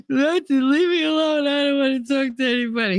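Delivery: put-on high, tearful child's voice; crying child. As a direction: sob it out like an upset little girl. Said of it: "I'm all crying".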